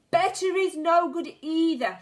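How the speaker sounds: high, loud shriek; witch shrieking. A narrator's reading. The Grand High Witch shrieks at the murmuring witches. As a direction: shriek the rebuke. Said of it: "shrieked the Grand High Witch".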